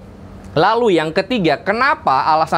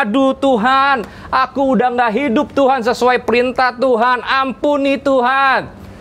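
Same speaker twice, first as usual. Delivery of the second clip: mock pleading; 7 semitones higher than usual. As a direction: act out a remorseful cry to God, lightly hammed up. Imagined Adam begging God for forgiveness. (memohon ampun, pura-pura menyesal)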